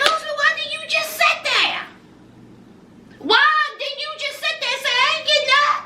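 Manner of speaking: high, fast, exasperated scolding; exasperated pleading. Real, not acted. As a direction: demand answers from him, high and fast. Scolding Usman, exasperated advice.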